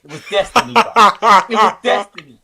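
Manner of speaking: loud, high triumphant declaration; loud proclamation. Real, not acted. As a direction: proclaim it like a prophecy. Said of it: "repeated, building".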